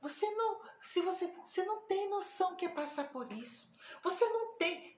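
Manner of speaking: high, loud indignant protest; retold flustered, annoyed outburst; imitated irritation. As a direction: act out a woman getting worked up. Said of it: "ela ficou um pouco irritada com o que eu falei".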